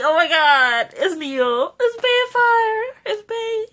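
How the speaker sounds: high, giddy, drawn-out gush; fangirling. Retold or imitated. Reviewer reliving her excitement at Neil, high giddy gush. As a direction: gush over the actor's name. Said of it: "I was like".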